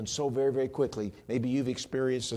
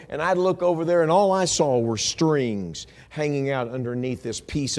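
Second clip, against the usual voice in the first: raised, loud storytelling from the pulpit; preach; 7 semitones higher, 10 dB louder than usual. Real, not acted. Preacher recalling mom's needlecraft, loud preaching voice. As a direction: project the memory to the whole room.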